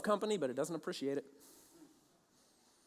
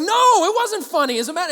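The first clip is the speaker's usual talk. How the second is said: indignant outburst; 10 semitones higher, 13 dB louder than usual. Retold or imitated. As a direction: explode in mock outrage. Indignant retort to bully.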